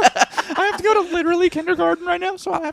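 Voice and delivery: put-on high childish voice; little girl voice. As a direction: play a small kid.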